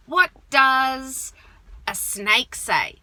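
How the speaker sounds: "What does" said loud, a pause, then "a snake say?" slowly; same tone with break. Shown as a demonstration. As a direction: keep the tune, pause mid-question. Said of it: "the exact same tone".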